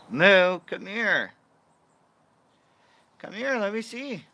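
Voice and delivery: high pet-talk voice; high calling voice for the dog. Real, not acted. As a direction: coo at the puppy.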